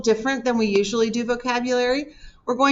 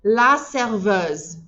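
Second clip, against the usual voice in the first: slow teacher model; much slower than usual. Shown as a demonstration. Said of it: "Say it after me".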